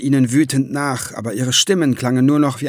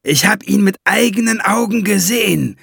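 calling out; 7 semitones higher, 3 dB louder than usual. Acted. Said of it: "rief Valiant ihm in das schwerhörige Ohr".

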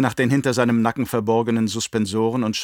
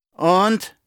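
loud, high, rising single-word demand; sharp, pressing question; impatient prompt; 9 semitones higher, 5 dB louder than usual. A narrator's reading. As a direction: prod him to go on. Baron presses captain for more.

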